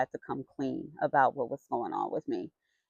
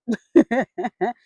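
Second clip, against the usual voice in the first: a real laugh, louder; loud burst of laughter; 5 semitones higher, 8 dB louder than usual. Real, not acted. Laughs at her own reaction.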